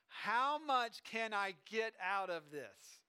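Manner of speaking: slow, drawn-out acted question; scheming eager kid. Kid wondering what five dollars buys, slowly eager. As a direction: savour each word, playing a kid maximizing money. Kid wants to maximize the money.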